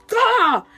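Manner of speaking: high, loud mock roar of rage; super angry yell. Streamer hit on the leg by a ring light, angry yell. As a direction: explode in a hammed-up cry of fury. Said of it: "I was super angry".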